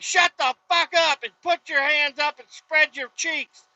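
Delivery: high-pitched imitation of an angry guard; hammed-up high guard yell; mock yelled orders. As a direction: shout over someone like an irate guard. Guard screaming orders, retold.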